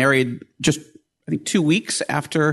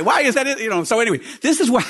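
ordinary talk

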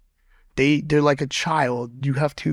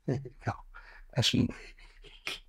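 breathy whisper to the dog; hushed doting whisper; 14 dB quieter than usual. Real, not acted. Talking fondly to his dog.

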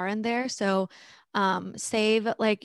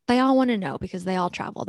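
ordinary delivery

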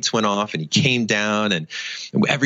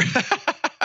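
high laugh. Real, not acted. Laughing at calling himself speaker.

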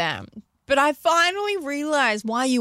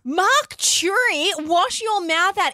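slow, high-pitched indignant telling-off; mock scolding; 6 semitones higher, slower than usual. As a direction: scold him slowly in playful outrage. Mock scolding of absent dad.

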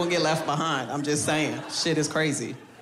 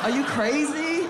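higher, slower, stretched disbelief; incredulous; 8 semitones higher, slower than usual. Shown as a demonstration. Comic asking the crowd, high and slowly incredulous. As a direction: drag out crazy like it's unbelievable.

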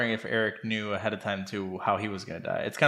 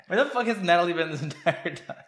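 laughing; 6 semitones higher than usual. Real, not acted. Repeats question laughing.

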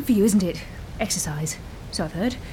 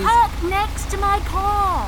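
calling out; 6 semitones higher, slower than usual. Acted. Telling the arriving guest where to park, called slowly. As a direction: call it across the rain.

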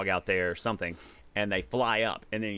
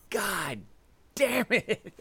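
dad's startled curse; 12 semitones higher, much slower than usual. Retold or imitated. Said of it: "And he was like".

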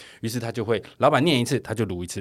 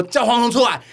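higher, louder mock shout; angry yell; 12 semitones higher, 6 dB louder than usual. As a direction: bellow it at the door like the husband. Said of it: "在门口大声的吼说". (模仿老公在门口大吼)

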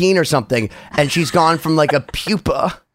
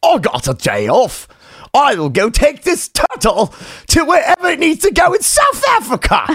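hammed-up British rocker impression, swelling higher; 9 semitones higher than usual. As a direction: declaim it in a put-on heroic British accent. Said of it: "So [name] was like".

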